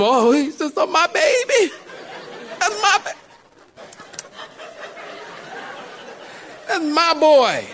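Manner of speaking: high, gushing mock mother; comically overcome, high-pitched maternal pride; doting mama. Groom's mama gazing at her son, high doting gush. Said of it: "except his mama".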